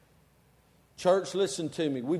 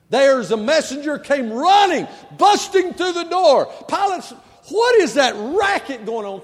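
loud, high, dramatic sermon storytelling; full-force preaching; 11 semitones higher, 11 dB louder than usual. Acted. Preacher telling of a messenger busting through the door, full-force preaching. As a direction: build the urgency of a messenger bursting in.

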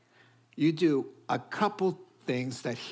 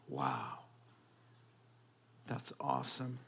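usual speaking voice

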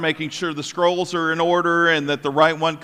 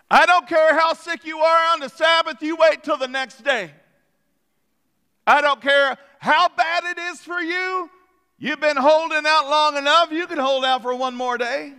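higher, harder voice of a scolding official; indignant synagogue leader; 9 semitones higher than usual. Acted. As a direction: bark the rule at the sick crowd.

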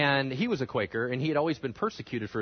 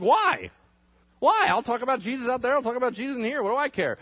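high, quick mock defiance; defiant retort; 8 semitones higher, 4 dB louder, faster than usual. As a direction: argue back as the unbothered preacher. Acted retort to shocked objectors.

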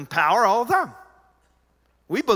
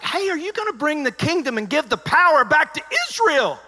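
high, loud eager question; eager disciples; 9 semitones higher, 4 dB louder than usual. Retold or imitated. Pastor voicing the disciples asking Jesus, eager and loud. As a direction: voice the disciples pressing for power.

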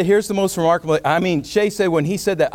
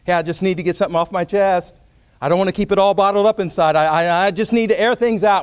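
higher, louder self-justifying outburst; mock venting, raised and pressing; 5 semitones higher, 6 dB louder than usual. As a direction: let it burst out to get it off your chest.

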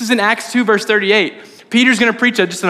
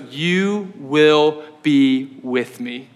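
gentle Jesus; 4 semitones lower, much slower than usual. Retold or imitated. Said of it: "And Jesus goes".

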